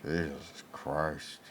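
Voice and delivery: low, soft and slow; muttered disgust. Real, not acted. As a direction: mutter it under your breath.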